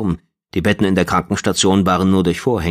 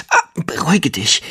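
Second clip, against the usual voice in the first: strained, pressed out; 9 semitones higher than usual. Acted. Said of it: "presste sie hervor".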